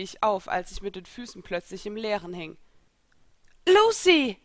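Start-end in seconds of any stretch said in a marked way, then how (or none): 3.7-4.4 loud, high cry of alarm; shocked scream of a friend's name; shocked shout. Acted. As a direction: yell her name in sudden fright. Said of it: "rief Elsa geschockt".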